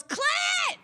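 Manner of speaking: shrill-free high yell of triumph; mom yelling. Retold or imitated. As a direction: blurt it out proudly like mom.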